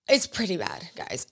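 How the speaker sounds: hushed confiding whisper to viewers. Real, not acted. To her viewers about a disturbing book, whispered. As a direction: drop to a breathy secret.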